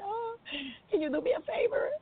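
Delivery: high-pitched imitation of a tearful plea. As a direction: plead as if upset, lightly acted. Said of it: "And he was crying".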